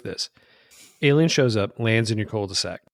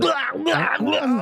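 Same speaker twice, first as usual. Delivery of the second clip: high, dismissive blah blah; mock babble; 12 semitones higher, much slower than usual. Host mocking the scientist's babble, high. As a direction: wave off the chatter.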